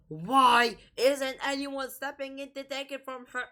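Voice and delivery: high, exasperated demand. Real, not acted. Asks why nobody steps in, exasperated. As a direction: pitch it up in loud disbelief.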